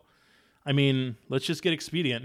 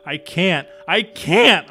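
rising desperate cry, louder on the second; cried out; 5 semitones higher, 9 dB louder, slower than usual. Acted. [name] cries he can't help, desperate rising cry. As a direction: cry it out helplessly. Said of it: "I cried".

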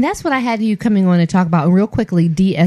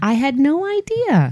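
amazed; 7 semitones higher, slower than usual. Acted. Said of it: "just heard you say that and said".

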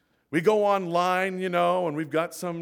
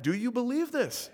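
higher, pressing the congregation for an answer; pressing question; 7 semitones higher than usual. Shown as a demonstration. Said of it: "I think we can do better than that".

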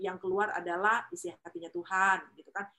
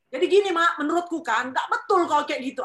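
loud, higher scolding of a parent; louder, pushy telling-off; bossy lecturing; 7 semitones higher, 9 dB louder than usual. Shown as a demonstration. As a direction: lecture your mother loudly, the wrong way. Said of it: "Jadi nggak pas".

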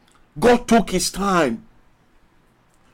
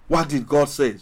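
normal talking voice

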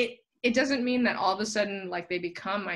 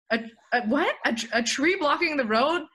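raised, stammered retold disbelief; acting out a confused double-take; baffled echo; 7 semitones higher, 3 dB louder than usual. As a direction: repeat it back as a puzzled question. Retells own baffled reply to driver.

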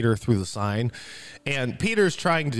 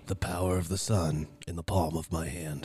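low, hushed villain voice; softly intoned movie-villain line; brooding villain quote; 8 semitones lower, 7 dB quieter than usual. Acted. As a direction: drop low and quiet like a menacing film villain. Quotes Doctor Octopus's famous film line.

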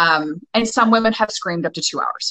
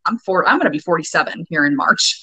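ordinary talk, nothing marked

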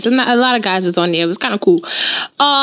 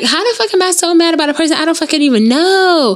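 exasperated self-talk; 7 semitones higher than usual. Retold or imitated.

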